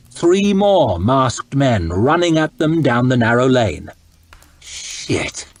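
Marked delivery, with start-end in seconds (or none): 4.6-5.5 whispered curse. A narrator's reading. Sees more men coming.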